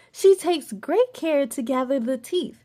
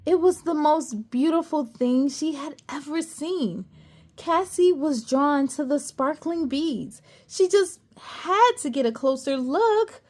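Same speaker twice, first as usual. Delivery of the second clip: plain reading voice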